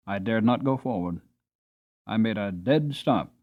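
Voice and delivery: ordinary delivery, nothing marked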